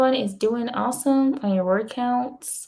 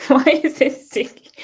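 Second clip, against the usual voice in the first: laughing; 5 semitones higher, 6 dB louder than usual. Real, not acted. Laughing at sticky stuff.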